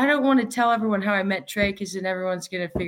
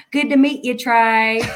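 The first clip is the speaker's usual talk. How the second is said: loud, higher mock Dallas twang; Dallas accent; 4 semitones higher, 7 dB louder than usual. Friend from Dallas greeting Trey after the show, Dallas accent. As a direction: imitate a friend's big Dallas accent, amused. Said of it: "so she's got this accent".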